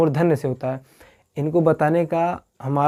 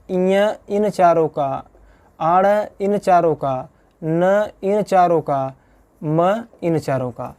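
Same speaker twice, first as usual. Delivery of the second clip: slow listing; slower than usual. Shown as a demonstration. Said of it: "teacher lists nasal letters slowly".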